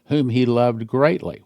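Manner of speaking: usual speaking voice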